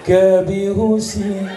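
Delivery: a hammed-up wail, slow and high, half sung; mock crying. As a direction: fake sobbing while singing. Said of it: "Sambil nangis, masih nyanyi dia". (meniru istri menangis sambil bernyanyi)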